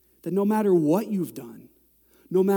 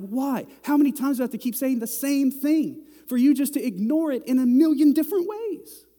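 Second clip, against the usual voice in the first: much higher, louder exasperated yell; frustrated parent; 9 semitones higher, 6 dB louder than usual. Retold or imitated. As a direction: blow up at kids who never listen. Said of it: "It makes me flaming mad".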